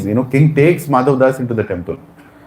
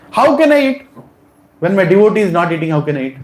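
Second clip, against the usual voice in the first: Jagannath refusing; 8 semitones higher than usual. Retold or imitated. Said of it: "Jagannath told".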